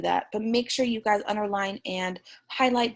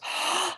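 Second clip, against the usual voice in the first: a sharp audible intake of breath; demo gasp. Shown as a demonstration. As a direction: gasp as if startled. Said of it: "That goes".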